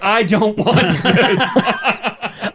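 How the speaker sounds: drawn out, laughing; slow laughing refusal. Retold or imitated. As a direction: stretch it out through laughter. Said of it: "I'm like".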